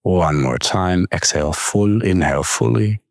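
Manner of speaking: calm, unhurried meditation guide with long pauses; guided meditation. Acted. Coach leading listeners with closed eyes into breath awareness, calm meditation voice.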